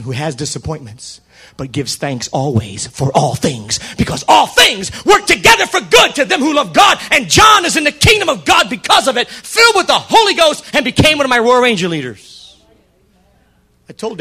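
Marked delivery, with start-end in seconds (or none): 1.6-12.6 higher, shouted sermon climax; preaching at full force. Real, not acted. Preacher driving home the point to the congregation. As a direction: build to a full preaching roar.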